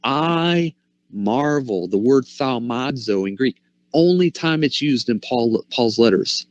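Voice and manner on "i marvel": "I marvel" stretched out very slowly; slow, wondering "I marvel"; drawn-out marvel. Shown as a demonstration. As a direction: linger on "I marvel" in awe. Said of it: "This, this is why he says".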